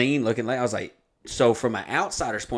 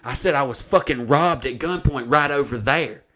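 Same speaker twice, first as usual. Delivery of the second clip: imitating mad woman; 3 semitones higher, 3 dB louder than usual. Woman insisting she was robbed at gunpoint, mock-angry. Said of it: "she got mad".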